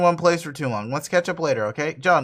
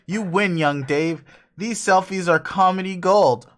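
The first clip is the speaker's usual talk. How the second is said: ordinary delivery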